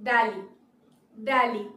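"Delhi" as DEL-ee, silent h; English pronunciation. Shown as a demonstration. Teacher presenting the next word, "Delhi" as DEL-ee. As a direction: say "Delhi" short, falling, as DEL-ee. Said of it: "আমরা বলবো".

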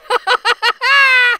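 high loud cackling laugh; gleeful cackle. A narrator's reading. Gingerbread boy laughing at chasers, high loud cackle. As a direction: cackle in triumph.

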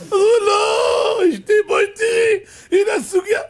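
very high, loud wailing husband; hammed-up desperate protest; frantic pleading. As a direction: plead in a panicked cry. Husband frantically explains the misunderstanding.

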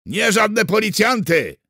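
slow, high, loud indignant yell; bellowing. A narrator's reading. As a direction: bellow the correction, drawing it out. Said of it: "wydarł się Miszczuk". (oburzony, przeciągły wrzask)

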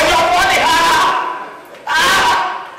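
hooting with laughter, high and drawn out; laugh-shouting at the top of the voice. Acted. Acting out the general's laughter.

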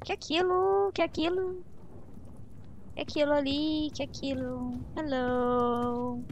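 ordinary talk, nothing marked

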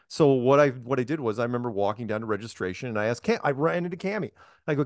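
ordinary talk, nothing marked

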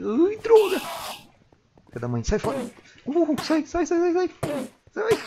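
high, loud battle yells; excited shouting. Real, not acted. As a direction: whoop in triumph at the fight. Fighting an Enderman.

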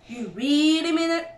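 amazed cry. A narrator's reading. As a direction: stretch "really" in rising amazement. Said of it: "cried Badger".

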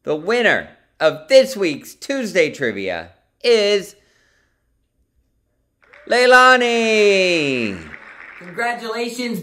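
hyped giveaway announcement. Acted. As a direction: build suspense, then belt the name. Announcing trivia winner.

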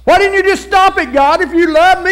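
high, loud anguished plea; pleading cry to God. Retold or imitated. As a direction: cry out at God, hurt and demanding. Child crying out to God.

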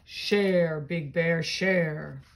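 a lightly voiced deep old tree. Reading a picture book aloud, the oak tree speaks, lightly voiced. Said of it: "A deep, low voice said".

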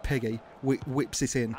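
normal talking voice

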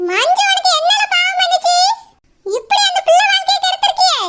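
high, mocking scold of the runaway groom; angry older woman. Mother scolding the groom who ruined her daughter, furious and mocking. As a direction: tear into him like an outraged mother.

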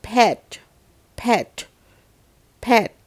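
"pet" short with a falling tone; "pet" punched out as an isolated falling word; model pronunciation. Shown as a demonstration. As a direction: model "pet" crisply, pausing between each repeat. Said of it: "จะได้เป็นคำว่า".

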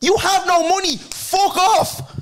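high, loud mocking yell; mock shout. Mocking the broke gambler at the counter, a loud high jeer. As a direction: jeer at the broke punter at full volume.

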